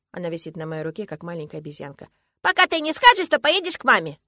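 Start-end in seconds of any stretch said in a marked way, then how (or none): 2.4-4.2 put-on high, loud childish ultimatum; stubborn little girl. A narrator's reading. Girl hanging on father's arm, stubborn. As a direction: dig in like a small child.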